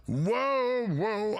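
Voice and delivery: normal talking voice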